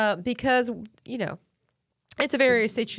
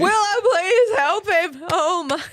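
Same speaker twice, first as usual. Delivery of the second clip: high, loud, slow, helpless wail; laughing mock plea; 9 semitones higher, 5 dB louder, slower than usual. Real, not acted. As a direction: plead for rescue, overcome with laughter. Mock plea after his bad accent.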